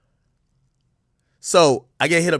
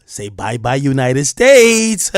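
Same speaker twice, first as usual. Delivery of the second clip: mock captor; 8 dB louder, much slower than usual. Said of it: "they'd be like".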